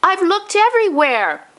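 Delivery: put-on high old man's voice, grave and upset; a grandfather announcing a tragedy; distressed grandpa.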